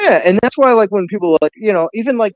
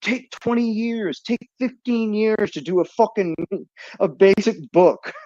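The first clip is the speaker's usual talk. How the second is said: mocking simp voice; 6 semitones higher, slower than usual. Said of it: "those simps who are like".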